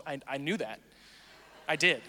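usual speaking voice

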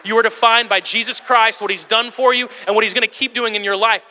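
building pulpit conviction; emphatic preaching. Real, not acted. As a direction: proclaim it to the congregation.

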